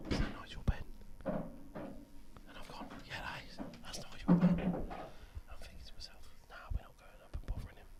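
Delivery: hushed, breathy whisper throughout; a whispered story to the room. Real, not acted. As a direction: keep it to a secretive whisper. Telling story while whispering.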